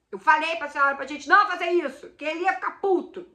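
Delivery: angry reproach. Retold or imitated. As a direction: blame her mother, loud and upset. Scolding mother on phone.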